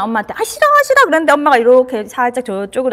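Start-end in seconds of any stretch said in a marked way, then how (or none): 0.4-1.0 put-on high, louder whiny child protest; whining child. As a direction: play the upset kid refusing. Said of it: "애가 그 들었잖아".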